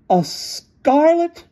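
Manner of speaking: very high, slow, stretched "scarlet"; shocked echo. A narrator's reading. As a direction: gasp out the word in disbelief.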